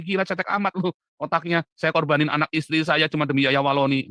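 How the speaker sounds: loud, scornful retold rebuke; retold scolding. Telling a friend he's shallow, loud scornful rebuke. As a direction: scold like you're fed up. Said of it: "saya bilang sama Muri Wali".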